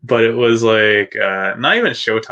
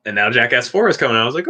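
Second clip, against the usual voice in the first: normal talking voice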